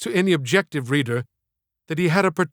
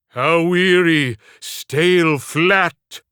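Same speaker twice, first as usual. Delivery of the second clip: slow, louder anguished stage delivery; tearful Hamlet; 4 dB louder, slower than usual. Acted. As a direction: weigh each word as a weary tragic prince. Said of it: "he permeated the language with fleshy tears".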